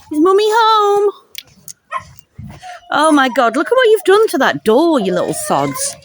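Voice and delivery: sing-song hello to the puppies; doting dog-owner voice at the door. Real, not acted. Talking to her puppies.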